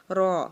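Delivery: را full-mouthed and rolled; heavy raa. Shown as a demonstration. Teacher models heavy را. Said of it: "منہ بھر کے ادا کی جاتی".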